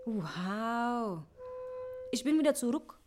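ordinary talk, nothing marked

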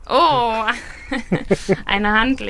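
a real, delighted-sounding "oh" of surprise; surprised. Real, not acted. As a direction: react loudly to an unexpected gift, pitch lifted. Unpacks a surprise gift from box.

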